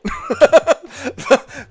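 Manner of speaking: a high, real burst of laughter; laughing. Real, not acted. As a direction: let a real laugh break out. Laughs at chat comment.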